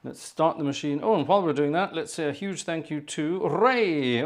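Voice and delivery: normal talking voice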